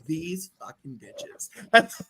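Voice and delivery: low, very slow and disgusted; disgusted roommate. Retold or imitated. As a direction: drag it out low with contempt. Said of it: "she's like".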